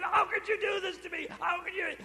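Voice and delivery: high, pained parental scolding; a relieved parent letting anger out; angry scolding. Pretend. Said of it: "And then comes the anger".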